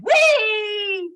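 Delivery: high, loud gleeful squeal; toddler squeal. Retold or imitated. Toddler in a store cart answering her mother, gleeful squeal. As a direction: squeal like a delighted baby.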